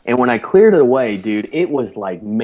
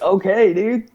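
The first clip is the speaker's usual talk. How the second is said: amused; 10 semitones higher than usual. Retold or imitated. Amused reply to the squealer.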